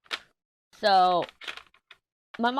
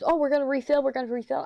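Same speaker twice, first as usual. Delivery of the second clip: higher, louder excited-kid imitation; pitched-up mock eagerness; eager kid quote; 9 semitones higher, 3 dB louder, faster than usual. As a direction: mimic a child rushing to refill. Voices excited son wanting a refill.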